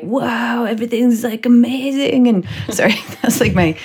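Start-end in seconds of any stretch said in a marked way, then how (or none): 0.0-2.3 lightly voiced dreamy stoner, higher; a hint of a spaced-out stoner. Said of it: "apparently my parents' stoner voice".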